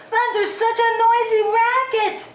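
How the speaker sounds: loud high duck shout; loud as he could. Acted. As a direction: quack it out over the thunder. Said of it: "loud as he could quack it".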